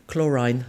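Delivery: Chlorine said klor-EYEN, rhyming with line; odd pronunciation. Shown as a demonstration. Mum mocking her own slip, Chlorine as klor-eyen. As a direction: Chlorine ending in a long eye sound, klor-rine. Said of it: "What did I say this morning, funny?".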